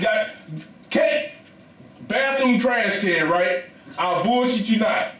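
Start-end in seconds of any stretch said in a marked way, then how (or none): none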